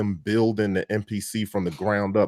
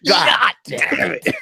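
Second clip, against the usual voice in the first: laughing; 5 dB louder, much slower than usual. Real, not acted. Echoing friend's curse, laughing.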